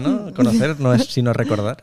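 ordinary talk, nothing marked

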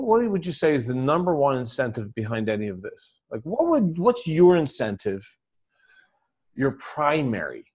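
ordinary delivery

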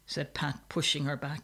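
plain reading voice

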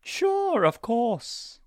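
high startled "sure"; flustered. Acted. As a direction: caught off guard, squeaky agreement. Flustered agreement.

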